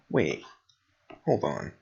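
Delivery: ordinary talk, nothing marked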